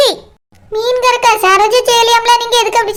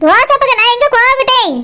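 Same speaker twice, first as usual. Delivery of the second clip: usual speaking voice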